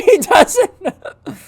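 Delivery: high, loud, laughing through the words; slow, breaking into giggles; real laughter. Real, not acted. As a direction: crack up while saying it. Laughing at the prank victim.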